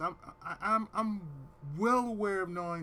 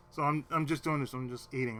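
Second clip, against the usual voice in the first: ordinary talk, nothing marked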